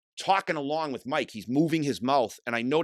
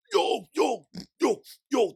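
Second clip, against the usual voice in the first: much higher mock yo chant; mocking hardcore singer; 10 semitones higher, slower than usual. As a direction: imitate a tough-guy stage call. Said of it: "he would do that fucking".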